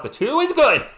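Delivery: loud, high shout as the shot drops; excited call. Real, not acted. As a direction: call the basket with a burst of joy. Live basket call, shot scores.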